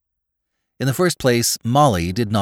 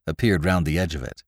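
ordinary delivery

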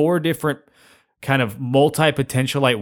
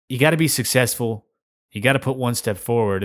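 usual speaking voice

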